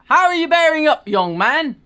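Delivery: put-on high, loud British old vicar voice; a cheery old British vicar asks. Vicar greeting young Maxim in a British accent. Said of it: "all the British accents here".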